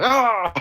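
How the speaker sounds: loud, high startled yelp; mock startled yell. Cohost acting out Nightcrawler's fright, loud mock yelp. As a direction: yelp as if shocked by the sight.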